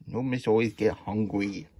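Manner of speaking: plain reading voice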